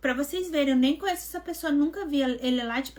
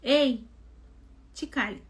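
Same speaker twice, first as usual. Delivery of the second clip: stern inner voice; slower than usual. Retold or imitated. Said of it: "eu ouvi uma voz assim".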